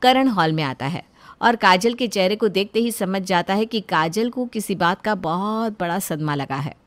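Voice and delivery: ordinary delivery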